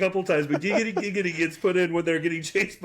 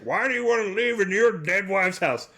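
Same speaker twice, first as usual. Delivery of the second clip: higher-pitched mock grandpa line; badly acted grandpa; 3 semitones higher than usual. Grandpa asking about the dead wife's house, bad voice acting. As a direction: imitate awful dub acting for the grandpa. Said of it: "The voice acting is awful".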